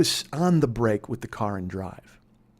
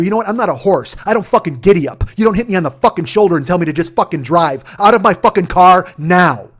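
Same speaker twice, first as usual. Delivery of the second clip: loud, high, heavily punched swearing; angry rant; 5 semitones higher, 11 dB louder than usual. Retold or imitated. Driver berates woman who hit him, angry shouted rant. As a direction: build a furious tirade to the final order.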